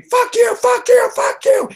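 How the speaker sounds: very high, loud squeal of hobbit rage; angry screaming. Acted. Player voices angry hobbit screaming while stabbing, high mock-furious scream. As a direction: scream it as a furious little halfling, far up in pitch. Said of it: "He's screaming".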